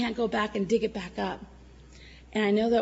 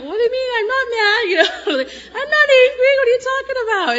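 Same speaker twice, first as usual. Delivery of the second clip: much higher and louder, crying out in denial; indignant protest; 12 dB louder than usual. Retold or imitated. Woman retelling how she protested to God, high loud tearful denial. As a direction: insist you're not angry, voice cracking into tears.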